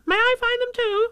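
very high squeaky pleading; eager. Acted. Roo begs Kanga to join, eager squeaky voice. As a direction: beg to come along. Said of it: "asked Roo eagerly".

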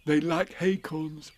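a hint of a gentle bear, unhurried; Pooh. A narrator's reading. Pooh explaining Tigger's tastes to Piglet, slow and lightly voiced. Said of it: "said Pooh".

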